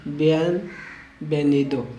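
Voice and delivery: "बियनवेनिदो" slowly in two parts, v as B; slow breakdown. Shown as a demonstration. Teacher breaks the welcome word down for learners, slowly.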